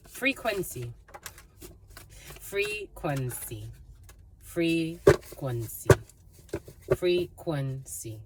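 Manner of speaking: "Frequency" at half speed, broken into separate syllables; slow, syllable by syllable. Real, not acted. Reader dwells on a word she received, slowly split up. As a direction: draw out each syllable of "Frequency", pausing between.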